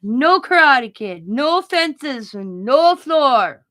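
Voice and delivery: loud, slow, emphatic doctor mimicry; doctor impression. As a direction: punch out each ban with a swooping fall. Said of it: "and that's how he said it".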